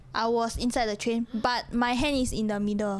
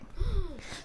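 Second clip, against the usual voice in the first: gasp; 8 semitones higher, 6 dB quieter than usual. Acted. Telling of watching own death.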